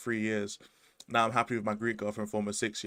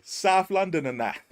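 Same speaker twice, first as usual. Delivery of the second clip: put-on higher, slow London accent; drawn-out exaggerated South London; mock South London accent; 8 semitones higher, 4 dB louder, slower than usual. Mocking the accent again.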